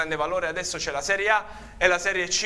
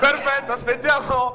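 laughing; 6 semitones higher, 4 dB louder than usual. Real, not acted. Host laughing after the story.

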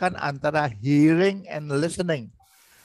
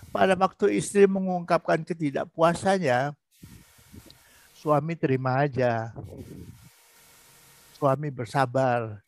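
usual speaking voice

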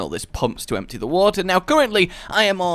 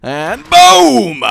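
excited boom; 11 semitones higher, 11 dB louder than usual. Real, not acted. Grenade exploding.

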